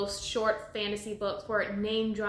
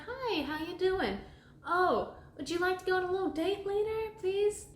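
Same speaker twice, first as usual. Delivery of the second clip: high-pitched, hopeful date request; shyly sweet mock suitor, high voice; 8 semitones higher than usual. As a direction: play a smitten boy, pitched up high. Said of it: "he's a little sweet on Smitha".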